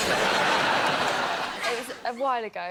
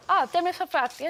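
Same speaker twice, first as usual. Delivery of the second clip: mock Welsh; 4 semitones higher than usual. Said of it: "are you Welsh as well?".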